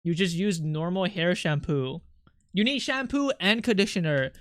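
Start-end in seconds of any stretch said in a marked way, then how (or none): none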